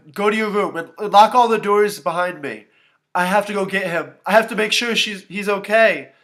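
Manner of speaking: loud, high, frantic orders; panicked urgency. A narrator's reading. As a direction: rush out commands in a panic. Panicked sitter rushing out.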